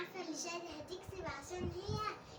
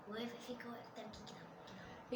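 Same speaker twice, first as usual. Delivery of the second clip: quick hushed whisper; 3 semitones lower, 10 dB quieter than usual. Acted. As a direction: whisper the plan so she won't hear. Teller plots the prank secretly. (همس)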